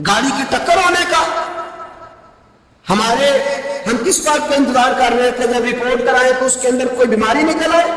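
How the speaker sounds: high, impassioned sermon cry with shouts; preaching at full force. Acted. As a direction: rouse them, keep it ringing high. Fiery exhortation to the congregation.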